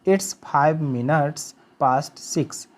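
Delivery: each word drawn out for learners; very slow model sentence. Shown as a demonstration. Teacher showing students how to say the time, very slow. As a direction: dictate it word by word, very slowly. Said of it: "तो इसको बोलेंगे".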